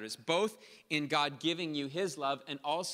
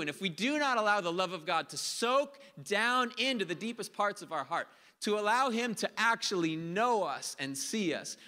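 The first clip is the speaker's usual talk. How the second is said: higher, louder preaching that drives each line home; full-force preaching; 6 semitones higher, 4 dB louder than usual. Acted. Pastor preaching hard to the congregation about God's love. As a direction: preach it out hard to the whole room.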